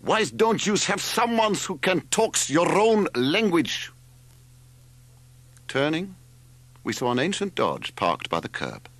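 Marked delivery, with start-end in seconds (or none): 0.0-3.9 put-on high, slow bellow in a thick foreign accent; roaring. A narrator's reading. Stranger calling over the uproar, high accented roar. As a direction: bellow it slowly, far above your usual pitch. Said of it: "a voice that rumbled out above the uproar".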